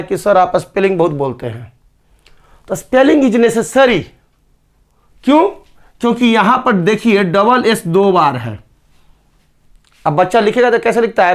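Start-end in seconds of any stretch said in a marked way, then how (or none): none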